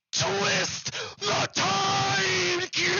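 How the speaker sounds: slow, high, theatrical proclamation; hammed-up dramatic announcer; mock magic incantation. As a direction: cry it out like a cartoon wizard casting a spell. Mock time-travel scene transition.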